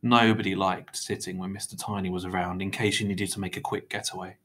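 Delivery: ordinary delivery, nothing marked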